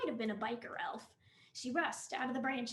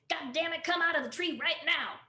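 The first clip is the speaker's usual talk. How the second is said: shouting order; 4 semitones higher, 6 dB louder than usual. Acted. Cop yelling at disguised car.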